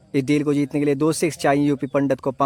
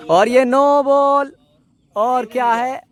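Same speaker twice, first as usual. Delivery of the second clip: high, slow, shouted surprise; excited shout; 7 semitones higher, 5 dB louder, slower than usual. Real, not acted. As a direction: call out the sudden twist. No ball and a catch. (अचानक ज़ोर से उत्साहित पुकार)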